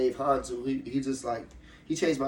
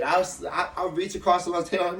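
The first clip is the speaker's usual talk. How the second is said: ordinary talk, nothing marked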